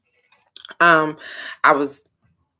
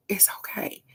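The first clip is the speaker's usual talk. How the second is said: a hushed, tender it's okay; whispered comfort; 4 semitones higher, 12 dB quieter than usual. Real, not acted. Comforting grieving viewers, a soft tender whisper. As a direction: whisper reassurance to someone hurting.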